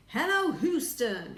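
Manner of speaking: loud, slow, high call; cried out. A narrator's reading. Astronaut hailing Houston over the mic, loud cry. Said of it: "he cried into the mic".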